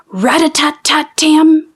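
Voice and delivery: high whispered rat-a-tat; whispered rattle. A narrator's reading. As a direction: patter the sound out in a whisper. Hare's dropping sound.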